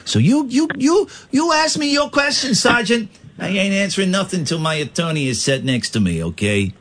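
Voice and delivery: high-pitched taunting mobster, easing down at the end; gangster. Pretend. Gangster challenging the sergeant until his attorney arrives, mock defiance. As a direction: dare the cop in a hammy gangster voice.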